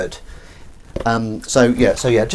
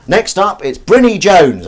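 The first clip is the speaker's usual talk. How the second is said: higher, louder, slow showman call; karaoke announcer; 9 semitones higher, 8 dB louder, slower than usual. Retold or imitated. Karaoke host calling the next act up, loud and drawn out.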